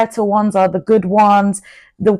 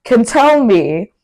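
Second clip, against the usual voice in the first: ordinary talk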